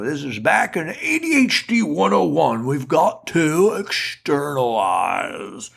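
put-on slow, rambling old professor voice; old-fashioned professor. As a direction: lecture like a fusty old professor. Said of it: "This is my old-fashioned professor voice".

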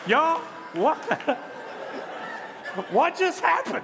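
high, loud, laughing disbelief; real amused surprise. Real, not acted. Preacher to congregation after a gadget interrupts, laughing in astonishment. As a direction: thrown completely, cracking up as you ask.

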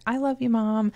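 soft, lightly voiced son's brush-off; a hint of an awkward kid; imitating sly son. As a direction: gently fend off Mom, as her son. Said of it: "he'll just slyly move it away".

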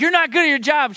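high, loud mock scolding; mock yelling boss. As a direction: imitate a tough boss berating a worker. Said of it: "he would come in yelling".

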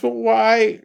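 high girlish imitation of a child; little girl voice. Girl asking her father why the cat must die, high little-girl voice. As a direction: ask it like a small girl. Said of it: "she goes".

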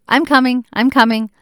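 mock child calling out she's coming; imitating daughter answering. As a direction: call it back like a kid from the other room. Daughter answering her mother.

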